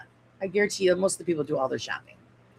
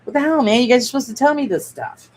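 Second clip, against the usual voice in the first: higher, louder playful outrage at the viewers; jokingly blaming the audience, raised and loud; mock exasperation; 6 semitones higher, 6 dB louder than usual. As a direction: act annoyed they didn't warn you. Scolds viewers over her own mistake.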